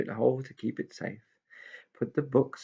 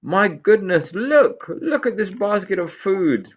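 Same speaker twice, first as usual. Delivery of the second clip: much higher, louder squeal of delight; delighted exclamation; 11 semitones higher, 8 dB louder, slower than usual. Acted. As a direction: cry out at the food. Pixie finds food basket.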